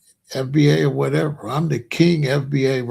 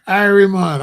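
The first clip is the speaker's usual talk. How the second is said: Jamaican accent, higher and louder; put-on Jamaican accent; 5 semitones higher, 6 dB louder than usual. Shown as a demonstration. Vlogger showing viewers how he doesn't talk, mock Jamaican accent. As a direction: exclaim it in a hammed-up Jamaican accent. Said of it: "I don't be like".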